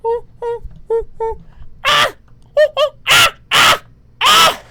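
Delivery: very high loud monkey hoots; shrill monkey ooh-ooh-ah screams; monkey screech. Shown as a demonstration. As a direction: hoot like a shrieking monkey, building. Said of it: "like a monkey ... a high evil laugh".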